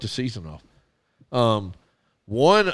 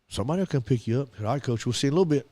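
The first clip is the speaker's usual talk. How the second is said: fast, softer, tossed off; rushed phone reply; 6 dB quieter, much faster than usual. Retold or imitated. Coach and boy quickly wrapping up a call, fast. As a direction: rattle it off quickly.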